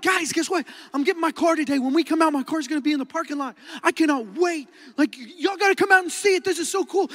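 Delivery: high-pitched excited bragging; pumped. Retold or imitated. Teen telling classmates about his first car, pumped. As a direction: gush to your friends, pitch held up. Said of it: "like, I'm pumped".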